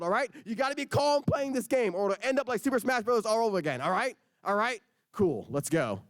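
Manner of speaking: rising, insistent stern uncle talk; stern lecture. Acted. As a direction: scold him like it matters.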